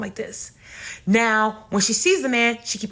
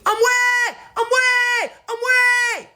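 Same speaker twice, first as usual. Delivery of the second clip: high, loud, drawn-out acted scream; screaming for help; 4 dB louder, much slower than usual. Aunt crying for help as she's robbed, screaming. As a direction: scream each cry on one sustained high pitch. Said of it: "She starts screaming".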